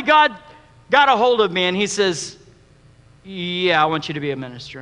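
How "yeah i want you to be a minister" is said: low, soft, matter-of-fact voice of God; a hint of God calmly calling him; lightly voiced God. God speaking.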